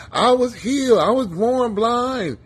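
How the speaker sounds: loud, high showy proclaiming; bragging to the whole town; hammed-up loud announcement; mock loud boasting. A loud show-off announcing healing.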